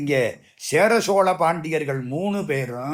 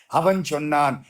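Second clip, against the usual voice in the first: ordinary delivery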